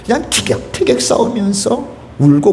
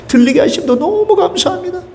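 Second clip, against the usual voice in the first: much higher, ecstatic thanks on 너무; soaring thanks; 14 semitones higher than usual. Pretend. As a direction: cry out 너무 감사합니다 high. Voicing grateful prayer.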